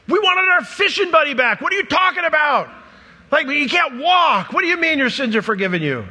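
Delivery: high, loud mock outrage; indignant protest. Disappointed friends protest to Jesus about their paralyzed buddy, indignant.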